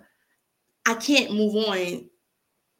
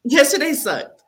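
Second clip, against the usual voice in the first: emphatic outburst; 7 semitones higher, 6 dB louder than usual. Real, not acted. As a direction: burst out higher and louder on yesterday sucked. Blurts out her real feeling.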